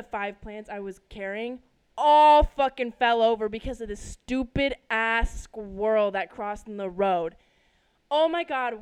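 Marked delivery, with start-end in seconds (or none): 2.0-7.4 loud, slow angry emphasis; exasperated rant. Real, not acted. Telling listeners how the plants fell, exasperated rant. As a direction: lean hard and loud on the curses. Fuming over fallen plants.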